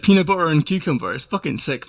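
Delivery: ordinary delivery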